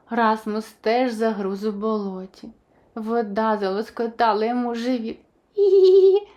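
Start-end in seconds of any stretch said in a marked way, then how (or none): 5.6-6.3 high, loud tickled giggle; giggling. A narrator's reading. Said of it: "засміявся Расмус".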